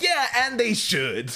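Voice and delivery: a high, slow, delighted yes; gleeful approval. Retold or imitated. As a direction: savor it like a happy fan. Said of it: "I'm like".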